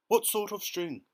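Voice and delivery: high, sharp and loud; sharp question. A narrator's reading. The grasshopper presses James sharply about the string. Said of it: "asked the old green grasshopper sharply".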